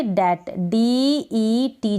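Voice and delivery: डैट said slowly with no b sound; silent b in डैट. Shown as a demonstration. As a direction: spell it out slowly: डैट, डीईटी. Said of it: "क्या पढ़ेंगे?". (धीरे-धीरे डैट, बी बिना बोले)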